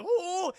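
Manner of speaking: high, loud wavering cry; hammed-up alarmed hoot; mock frightened ooh. The pair being pulled up the vine, high mock cry.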